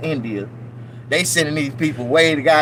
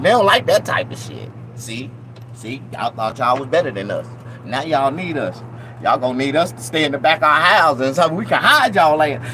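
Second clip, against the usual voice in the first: ordinary talk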